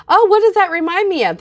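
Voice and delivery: talking like this. Shown as a demonstration. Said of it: "maybe if you just talk like this".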